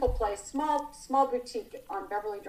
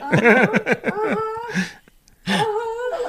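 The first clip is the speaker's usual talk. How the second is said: slow, much higher timid uh-huhs; shy kid; 10 semitones higher, 9 dB louder, much slower than usual. Retold or imitated. To Mickey Rourke, a shy starstruck kid mumbling slow uh-huhs. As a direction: answer like a bashful, intimidated kid. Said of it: "like a total kid".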